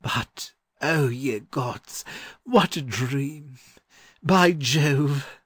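high, slow marvelling scholar; feverish wonder. A narrator's reading. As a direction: exclaim in dazed, feverish amazement. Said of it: "said the learned gentleman feverishly".

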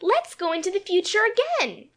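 put-on very high little-girl voice, bright; bright suggestion. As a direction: pipe up brightly as a small girl. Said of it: "suggested Jane brightly".

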